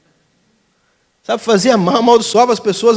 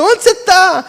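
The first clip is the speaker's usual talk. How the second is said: high, playful child imitation; childlike hide-and-seek call; 9 semitones higher than usual. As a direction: call out like a kid playing seeker. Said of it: "Igual criança".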